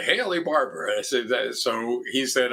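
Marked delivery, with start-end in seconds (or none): 0.0-0.9 a hint of the governor, pitched higher; lightly voiced governor on the phone; Haley Barbour. Governor phoning the new foundation president, lightly imitated.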